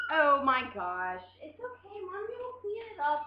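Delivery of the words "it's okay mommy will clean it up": higher and much softer reassurance. Real, not acted. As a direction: gently hush the little one that nothing's wrong. Mom comforting daughter after spill.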